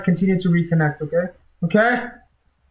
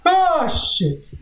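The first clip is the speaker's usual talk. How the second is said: high startled curse; scared yelp; 11 semitones higher than usual. Real, not acted. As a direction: jump at the sound. Jump scare in game.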